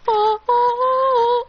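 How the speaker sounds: high, loud, slow ah-ah-ah; drawn-out high gasping; salty gasps. Acted. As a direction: pant from the salty sting. Eating ramen with lots of salt.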